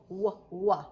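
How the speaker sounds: "W" said as a short wuh sound; "W" as a rounded wuh; letter sound W. Shown as a demonstration. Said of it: "which is a W".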